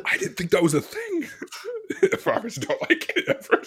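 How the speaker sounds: high, squeezed out through laughter; helpless real giggling while talking; laughing. Real, not acted. Comic confessing a failed cow tipping joke, laughing. Cracking up at own story.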